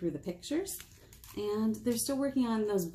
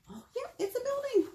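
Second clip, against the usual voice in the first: much higher, light answer; high bright guess. Acted. Librarian answering for the kids, high and eager.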